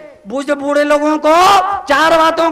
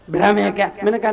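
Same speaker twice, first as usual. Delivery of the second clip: normal talking voice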